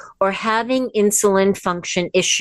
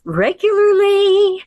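"regularly" high and drawn out, louder at its peak; emphatic repetition; 12 semitones higher, 4 dB louder, slower than usual. Real, not acted. Teacher urging listeners to stay active, emphatic "regularly". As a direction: punch "regularly" in a high, sustained pitch.

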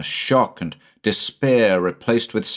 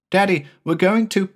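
put-on much higher, fast eager child; a boy bubbling about his plans; high quick child's voice; excited little boy; 12 semitones higher than usual.

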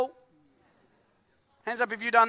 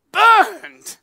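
much higher, much louder pained yelp on the word; pained cry; 6 semitones higher, 11 dB louder than usual. Retold or imitated. The preacher relives chili in his eye, crying out. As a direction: cry out burned as if it stings.

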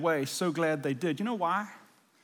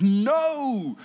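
loud, higher "No"; a firm, raised refusal; emphatic no; 5 semitones higher, 6 dB louder than usual. Retold or imitated. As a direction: Peter protesting hard. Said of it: "Peter declared emphatically".

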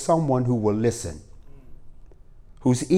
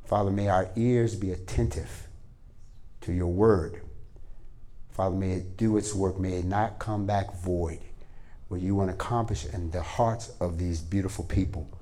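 quiet, earnest prayer voice, a bit softer than the preaching; 5 semitones lower, 9 dB quieter than usual. Acted. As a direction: pray gently over the people. Pastor prays over the congregation.

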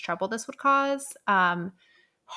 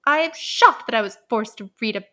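mock outraged reviewer; 5 dB louder than usual. Hate-mail reviewers attacking Lucy's book, mock outraged and louder. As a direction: ham up a scandalized reader.

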